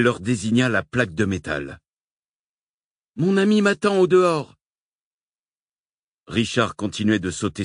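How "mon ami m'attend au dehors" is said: cri aigu et fort, suppliant; cri pressant. A narrator's reading. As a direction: implorer par-dessus le vacarme. Supplie les aviens bruyants. (Nicole crie aux aviens, aiguë et forte)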